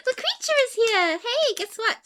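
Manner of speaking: high, loud excited squeal; excited pet talk. Real, not acted. Streamer welcoming the creature for a treat, high excited voice. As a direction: gush to a pet that just arrived.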